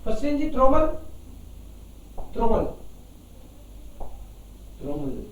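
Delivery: normal talking voice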